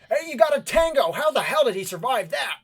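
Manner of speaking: high, loud alarmed shout; yelling out. Acted. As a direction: yell a warning across the room. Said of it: "yell out".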